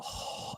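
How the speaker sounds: soft voiceless gasp of awe; a hushed mock sigh of wonder; breathy awed oh. Fans reacting to the guru's wisdom with a mock awed gasp. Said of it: "That would be the sound you'd have to make".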